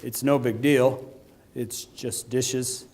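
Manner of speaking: slow, soft, unbothered; calm shrug. Retold or imitated. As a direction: lay it out like it's nothing. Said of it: "He said".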